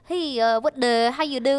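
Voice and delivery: choppy mock Vietnamese accent; slipping into Vietnamese accent. Shown as a demonstration. Comedian showing her accents collapse, mock Vietnamese accent. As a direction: Indian accent attempt drifting into Vietnamese. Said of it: "back in, like, Vietnam".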